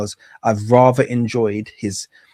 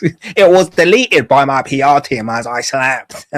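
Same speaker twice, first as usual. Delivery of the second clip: slow, louder mock of his drawn-out boast; imitating Andrew Tate; 2 semitones higher, 6 dB louder, slower than usual. Host mocking Andrew Tate's excuse for viewers, slow, louder imitation. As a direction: parody the smug excuse, slowly. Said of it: "Isn't the way this guy talks?".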